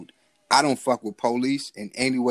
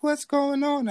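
high mock voice of an old grandmother; slower than usual. Grandmother at the door asking the strangers, high mock voice. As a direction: squeak it out like a startled grandma. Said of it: "She was like".

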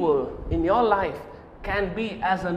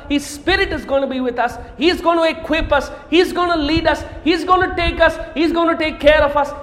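building preaching; 5 semitones higher than usual. Acted. Preacher rallying the congregation, building up. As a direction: preach it, climbing.

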